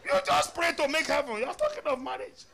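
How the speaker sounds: high, loud preaching; full-force preacher's rebuke. Acted. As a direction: thunder the rebuke from the pulpit.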